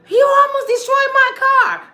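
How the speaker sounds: high and loud outrage; loud complaint. Retold or imitated. Husband complains loudly.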